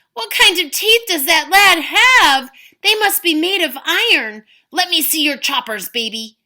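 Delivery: loud, high demanding bellow; demanding giant. Acted. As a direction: bark for a look.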